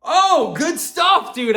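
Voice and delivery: high, loud burst of approval; put-on enthusiasm. Replying to the gym guy's find, put-on enthusiasm. Retells his encouraging reply to newcomer.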